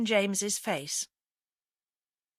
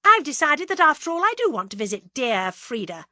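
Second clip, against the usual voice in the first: loud, irritable retort, pitched up; snapped; 6 semitones higher, 8 dB louder than usual. A narrator's reading. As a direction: snap back with a barbed "dear". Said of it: "snapped Agatha".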